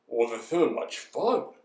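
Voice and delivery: low elephant grumble; grumbling elephant. Acted. Elephant stuck in storm, low grumble. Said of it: "the poor elephant grumbled".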